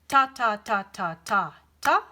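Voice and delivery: "ta, ta, ta, ta, ta" chanted in time; rhythm syllables. Shown as a demonstration. Teacher chanting a rhythm for her student, sounding out each "ta". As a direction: tap out the rhythm on "ta". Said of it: "That's one rhythm".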